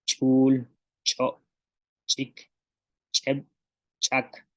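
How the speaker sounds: first consonant of each word from "puus" to "chak" popped as an ejective; isolated model words like "puus" and "chak", glottal burst on the opening consonant; glottalised consonants. Shown as a demonstration. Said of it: "que llevan glotal".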